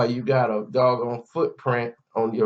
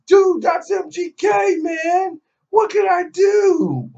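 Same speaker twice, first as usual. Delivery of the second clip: very high, loud falsetto squeal; mock helpless protest; 7 dB louder, slower than usual. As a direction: plead helplessly as the star-struck manager, hammed up. Acts out the helpless star-struck manager.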